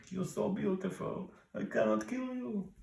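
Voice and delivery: high, put-on ancient Egyptian mummy accent. Mummy smitten by her eyes, softly in a high mummy accent. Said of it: "What kind of an accent is that?".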